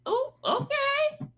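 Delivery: a sudden high, drawn-out oh okay; surprised. Real, not acted. Realizing what the chat member thought of her, surprised. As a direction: caught off guard, then amused.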